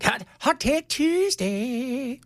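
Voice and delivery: slow, hammed-up announcement; mock radio jingle voice; drawn-out show title. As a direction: stretch the title like a show intro.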